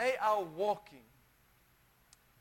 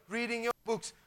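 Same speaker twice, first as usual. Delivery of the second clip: ordinary talk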